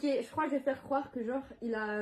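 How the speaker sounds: normal talking voice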